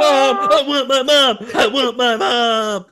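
high, loud wailing for mom; mock dying soldier yell. Retelling a toy ad's dying soldier: high, loud wail. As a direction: wail like a wounded soldier, hammed up. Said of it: "That's what the guy's yelling".

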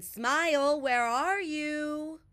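high, loud, slow searching call. A narrator's reading. Bear calls through the open door for Smile, loudly. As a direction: stretch the call out to find him. Bear calling for lost friend.

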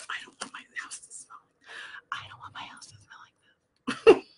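unvoiced hushed whisper; secretive whisper. Real, not acted. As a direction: whisper it so no one hears. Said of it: "I have to whisper it".

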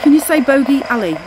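high, loud plea to the spirit box; calling out. Real, not acted. Ghost hunter urging the spirit box to speak, loud call. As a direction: call the name out clearly.

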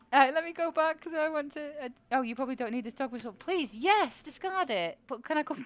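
ordinary talk, nothing marked

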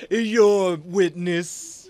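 very slow, drawn-out mock courtesy; mocking flourish. Player inviting Justin into his own doom, mockingly gracious. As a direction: present it grandly, stretching each word.

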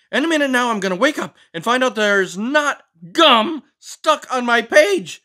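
high, loud frantic frog; frog panicking. Acted. Finn the Frog panics at grape juice on his pages, high and loud. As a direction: lose it over the ruined pages.

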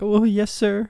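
high, meek, timid yes-sir; cowardly. Retold or imitated. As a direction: squeak it like a coward. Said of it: "like, a cowardly".

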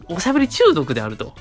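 ordinary delivery

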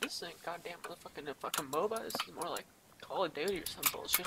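usual speaking voice

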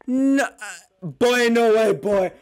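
usual speaking voice

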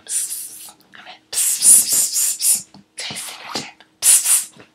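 put-on drunken psst whisper. Drunk wife whispering to her husband. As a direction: hiss secretly for him.

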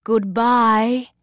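loud, called-out goodbye; bird's farewell. A narrator's reading. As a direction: call out a farewell as the bird. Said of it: "says the bird".

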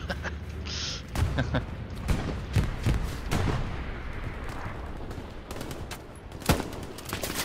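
laughing. Real, not acted. Both threw frags together.

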